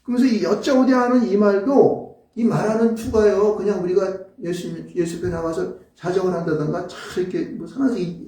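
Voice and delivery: usual speaking voice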